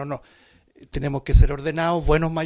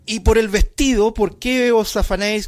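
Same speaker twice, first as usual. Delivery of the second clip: raised, emphatic Bible reading; scripture reading; 6 semitones higher, 6 dB louder than usual. Acted. Preacher reading the verse aloud to the congregation, proclaiming.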